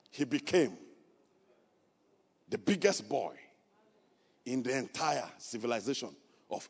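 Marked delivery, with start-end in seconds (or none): none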